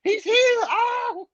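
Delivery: high, loud starstruck squeal; high fangirl squeal over a reptile YouTuber. Retold or imitated. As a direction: gush like a starstruck fan. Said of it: "I was fangirling".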